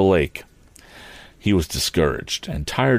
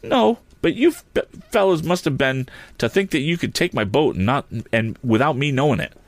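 high-pitched indignant retort; outraged young hero; wrathful; 8 semitones higher than usual. A narrator's reading. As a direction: argue back hotly, pitched up. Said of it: "filled with wrath".

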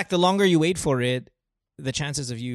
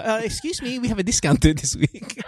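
mock wife texting; 6 semitones higher than usual. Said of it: "Siya, siya magte-text".

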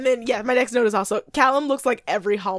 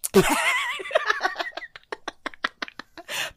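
high burst of laughter. Real, not acted. Laughing at the pass.

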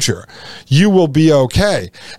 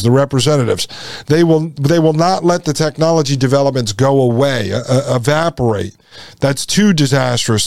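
normal talking voice

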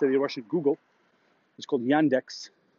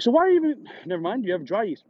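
incredulous outburst; 7 semitones higher than usual. Retold or imitated. Retold exasperated question.

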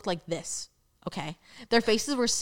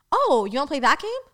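retold challenge; 6 semitones higher, 4 dB louder than usual. Retells own indignant reaction.

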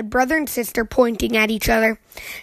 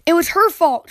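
cub's high accusation; 6 semitones higher, 4 dB louder than usual. Acted. Cubs blame each other again.

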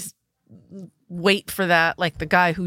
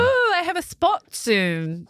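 put-on voice; 10 semitones higher, slower than usual. High slow put-on voice.

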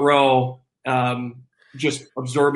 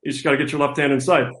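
ordinary delivery

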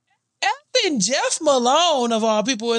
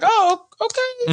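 hesitant oh okay; 10 semitones higher, slower than usual. Retold or imitated. Tepid partner reaction.

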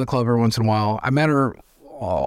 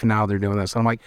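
usual speaking voice

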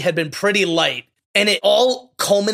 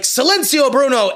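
higher, louder quoted rallying cry; retold battle cry; 7 semitones higher, 4 dB louder than usual. Alberto urging Luca down the big hill, retold rallying cry. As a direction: call it out as a mock command. Quoting a reckless dare before riding.

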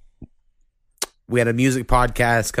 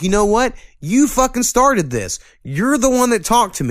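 mock angry retort; 11 semitones higher, 4 dB louder than usual.

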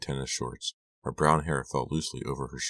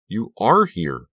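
loud, high, drawn-out exclamation; slow astonished cry, leaning hard on "are"; amazed cry; 7 semitones higher, 8 dB louder, slower than usual. A narrator's reading. Girl finds Lucy hiding in the barn, amazed cry. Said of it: "I cried".

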